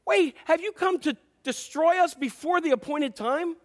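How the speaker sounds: high, mock-panicked protest; freaking out. Demons begging Jesus before the appointed time, freaking out. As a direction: plead in exaggerated alarm. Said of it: "They were freaking out".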